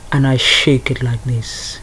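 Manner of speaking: deliberately bad Caribbean accent, hammed up. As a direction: put on a clumsy Caribbean accent. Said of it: "This is such a bad Caribbean accent".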